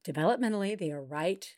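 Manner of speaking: put-on hushed reassuring tone of a child expert; Teresa voice. Host switches to a calm expert voice about toddlers, soft and soothing. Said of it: "Let me just do my Teresa voice".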